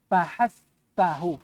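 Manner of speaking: Fahassahu drawn out slow and hissed; slow mnemonic. Shown as a demonstration. Teacher spells out the hamas mnemonic. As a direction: stretch Fahassahu syllable by syllable. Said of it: "hafalkan ini".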